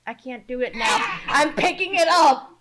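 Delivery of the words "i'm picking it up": put-on loud, higher muddled New York-Southern accent; botched hybrid of New Yorker and Southern; New Yorker-Southerner mix. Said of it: "mixture of the New Yorker and the Southerner".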